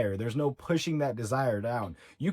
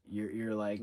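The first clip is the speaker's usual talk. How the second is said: ordinary talk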